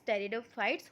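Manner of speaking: pteridophytes said as "teridophytes"; silent P. Shown as a demonstration. Teacher repeating the pronunciation of pteridophytes for students, P silent. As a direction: drop the P in "pteridophytes", starting on T. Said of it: "In this P is silent".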